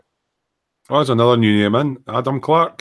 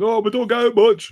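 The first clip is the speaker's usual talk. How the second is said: mimicking the group; 12 semitones higher than usual. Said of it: "hears us all going".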